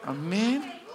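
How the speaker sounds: usual speaking voice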